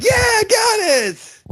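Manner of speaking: long, drawn-out high yell; triumphant shout. Retold or imitated. Pranksters landing a grape through a gauge, yelling triumphantly. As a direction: whoop it out, stretched and very high. Acts out celebrating a successful throw.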